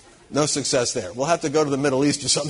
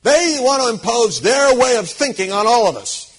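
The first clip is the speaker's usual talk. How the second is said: loud, urgent, higher voice of a worried critic; alarmed warning; 4 semitones higher, 6 dB louder than usual. Retold or imitated. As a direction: sound the alarm about a global threat.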